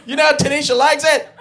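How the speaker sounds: high, exasperated mock scolding; hammed-up frustration; annoyed demanding friend. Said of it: "You're like".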